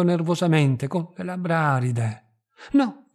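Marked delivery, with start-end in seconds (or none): 2.7-3.1 sharp high cry, louder; a sudden frightened no, shouted; high loud refusal. A narrator's reading. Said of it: "gridò lei".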